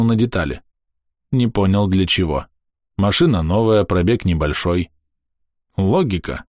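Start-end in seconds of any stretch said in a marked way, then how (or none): none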